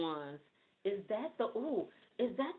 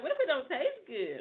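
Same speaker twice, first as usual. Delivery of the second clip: laughing doubt; 7 semitones higher, 5 dB louder than usual. Retold or imitated. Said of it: "but I'm like".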